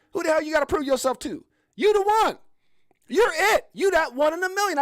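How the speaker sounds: loud, high motivational shout; fired-up hype. Real, not acted. Host telling viewers they are one in a million, shouted. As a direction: holler it like a pep talk.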